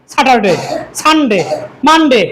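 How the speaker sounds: "ডে" cut short as a plain "de", no glide; common mispronunciation. Shown as a demonstration. Teacher showing how learners wrongly say weekdays, short endings. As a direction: end "সানডে" on a short, falling "de". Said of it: "সঠিকভাবে না জানার কারণে আমরা কিভাবে বলি".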